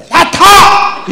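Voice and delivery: loud, drawn-out barked order; yelling at his own wife to get in; gruff shout. Retold or imitated. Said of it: "이러고 소리 지르고 가잖아요". (자기 아내에게 타라고 크게 소리치는 남편)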